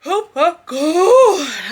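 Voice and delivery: words stretched through a big real yawn; slow yawning laugh. Real, not acted. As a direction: yawn right through it, pitch up. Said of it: "if my yawning is causing you to yawn".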